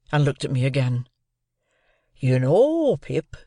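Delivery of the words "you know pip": Joe's earnest voice, swooping high on "know"; solemn. A narrator's reading. Joe turning to young Pip, solemnly. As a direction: address the boy with rustic solemnity. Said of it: "said Joe solemnly".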